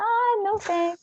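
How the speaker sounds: high, light polite no thanks; polite refusal. Retold or imitated. A tired paddler politely declining a sandwich, high and light. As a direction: brush it off sweetly.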